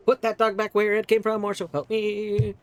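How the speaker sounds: loud, high mock threat. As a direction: threaten it in jest. Threatening to put dog back.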